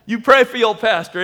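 ordinary talk

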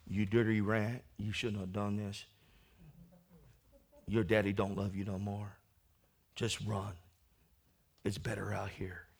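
lightly voiced devil; devil's taunt. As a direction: sneer like the devil tempting.

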